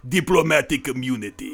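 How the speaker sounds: put-on South African villain accent; villain's line in a South African accent. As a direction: imitate a movie bad guy from South Africa. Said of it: "bad guy talked like this".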